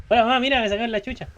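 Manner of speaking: put-on high, loud pleading little-boy voice; calling his mom like a beaten-up kid; whiny kid to mom. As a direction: play the hurt son crying to his mother. Whining to game mother.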